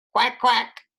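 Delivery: Henry joining the animal game loudly; loud quacking. A narrator's reading. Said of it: "Henry says loudly".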